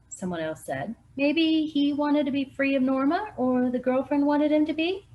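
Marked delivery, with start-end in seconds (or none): 1.2-5.1 local voice. Acted. Another local voiced by narrator.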